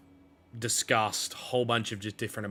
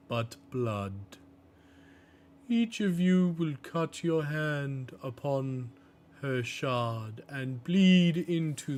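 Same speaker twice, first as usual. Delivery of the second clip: slow, raised-pitch ceremonial character voice; Bosun, solemn ritual pronouncement; 4 semitones higher, slower than usual. Acted. Ritual keeper instructing captains on the blood vote, slow solemn intoning. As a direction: intone each phrase slowly, like an ancient rite. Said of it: "Bosun then speaks and says".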